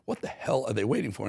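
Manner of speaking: ordinary delivery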